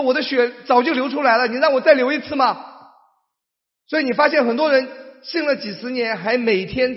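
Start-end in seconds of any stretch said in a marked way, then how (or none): 0.0-2.6 higher, louder reproachful reply; Jesus exasperated. Retold or imitated. Jesus answering a believer who keeps begging, reproachful. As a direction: ask it back in exasperation.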